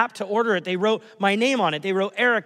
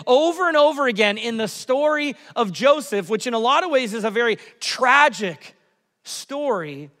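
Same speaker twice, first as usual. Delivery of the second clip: ordinary talk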